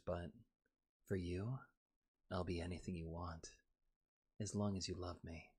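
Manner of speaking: soft, low, breathy-close ASMR voice; hushed seductive ASMR tease; mock ASMR. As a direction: purr it softly into the mic. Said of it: "Sorry, I'm not doing A S M R".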